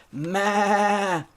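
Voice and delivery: long, high "maa"; goat bleat. Acted. Goat greets little pig with a drawn-out bleat. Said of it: "Mister Goat".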